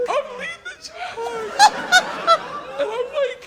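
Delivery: high-pitched laughing. Real, not acted. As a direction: struggle on while cracking up. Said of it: "He's talking in such high pitch".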